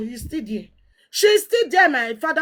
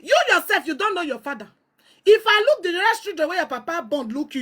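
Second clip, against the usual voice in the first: ordinary talk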